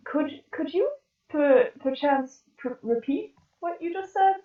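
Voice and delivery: refined, high character voice; Japsor. Acted. Hard-of-hearing Japsor asking Tara to repeat, refined character voice. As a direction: raise the pitch for a halting, refined request. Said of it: "she's much more refined".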